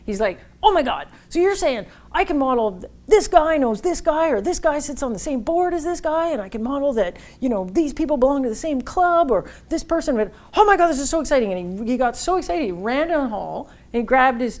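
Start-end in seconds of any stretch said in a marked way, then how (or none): 0.6-10.1 excited banker. Retold or imitated. Excited banker voiced in story. 10.6-11.5 much higher and louder, thrilled; gushing with excitement. Retold or imitated. Goldman banker gushing to the sales reps, high and excited.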